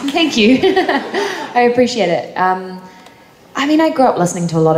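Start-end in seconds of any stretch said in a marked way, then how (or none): 0.1-2.3 giggly, embarrassed gratitude; laughing thanks. Real, not acted. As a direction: laugh through the thanks, slow and bashful. Thanked for praise of accent.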